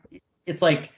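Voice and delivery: ordinary talk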